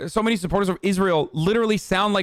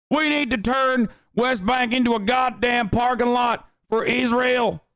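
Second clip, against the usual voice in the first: mock MAGA accent; 6 semitones higher than usual. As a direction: do a hammed-up Trump supporter accent, pitched way up. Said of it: "I'll do the accent for you".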